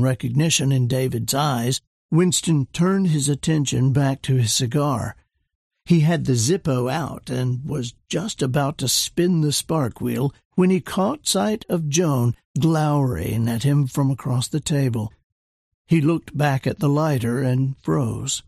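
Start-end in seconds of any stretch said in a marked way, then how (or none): none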